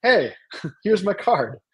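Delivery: high, drawn-out flattery; slow, swooping sales charm with a little laugh; mock schmoozing. Leader slipping young Mason a business card, mock schmoozing. Acts out sly pitch to young man.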